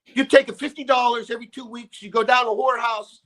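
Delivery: dad giving his son crude advice, high and loud; imitating dad.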